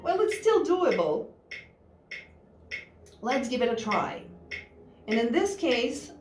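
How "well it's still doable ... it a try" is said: ordinary talk, nothing marked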